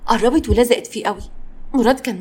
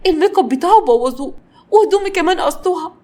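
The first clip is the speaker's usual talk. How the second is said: high, loud shocked outrage; worked-up, high-voiced exasperation; exasperated fury; 8 semitones higher, 3 dB louder than usual. A narrator's reading. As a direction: fume in disbelief, pitched high. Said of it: "قعدت تتكلم بغيظ وحرق الدم".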